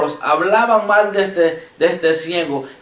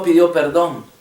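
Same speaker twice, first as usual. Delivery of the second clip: normal talking voice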